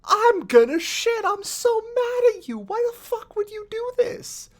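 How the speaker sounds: high, ranting mock fury; Toland furious. Toland raging at the Guardian for dropping the power, high mock tantrum. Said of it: "And Toland goes".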